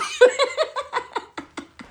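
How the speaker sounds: high breathless laughing fit; real cackle. Real, not acted. Laughing at own wolf joke, high real cackle. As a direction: burst out cackling.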